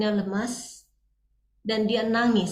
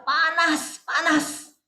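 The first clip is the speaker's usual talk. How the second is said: higher-pitched mock yell, held back in volume; teriakan tiruan; 6 semitones higher, much slower than usual. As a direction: imitate a woman crying out in a high voice. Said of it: "Dia langsung teriak". (teriakan tiruan bernada tinggi)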